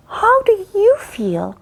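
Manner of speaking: drawn-out high ask; slow, high question. Shown as a demonstration. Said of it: "teacher asks slowly".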